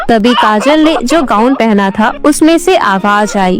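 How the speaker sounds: ordinary delivery, nothing marked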